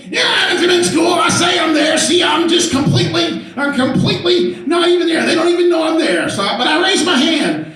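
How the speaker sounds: put-on high, loud laughing teen girl voice; carefree teen daughter. Daughter admitting to dad she skipped school, high laughing teen. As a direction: toss it off like a teenager who doesn't care.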